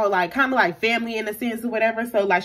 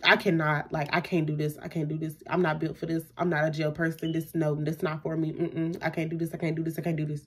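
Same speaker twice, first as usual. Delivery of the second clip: hushed replay of freaking out; retold shaky panic; 2 semitones lower, 7 dB quieter than usual. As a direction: mutter it over and over, acting out dread. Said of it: "I'm really, like, shaking".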